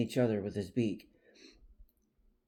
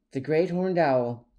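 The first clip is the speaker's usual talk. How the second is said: ordinary delivery, nothing marked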